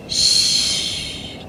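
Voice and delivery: soft whispered shush; breathy menacing shush; hushing. Acted. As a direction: hush her, voice gone to breath. Villain hushes the captive.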